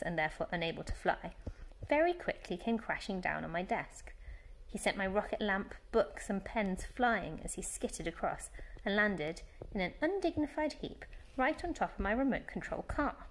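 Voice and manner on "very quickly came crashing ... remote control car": ordinary delivery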